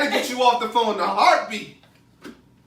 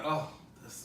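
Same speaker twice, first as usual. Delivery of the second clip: breathy mock dread, barely voiced; whispered groan; 8 semitones lower, 18 dB quieter than usual. Unvoiced groan at an unwanted call. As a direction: sigh it out, annoyed at the caller. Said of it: "You like".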